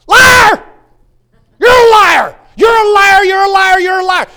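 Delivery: very high, very loud repeated yell; shouting liar. Shown as a demonstration. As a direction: scream it at the devil, again and again. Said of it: "you gotta do this sometimes".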